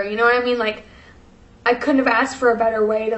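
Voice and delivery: ordinary talk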